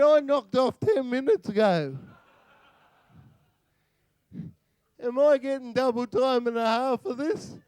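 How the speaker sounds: high, loud, indignant ocker worker; outraged Aussie bloke who's knocked off for the day; Aussie first officer protesting the orders loudly; Commander Smith. Acted. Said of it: "Commander Smith be standing there going".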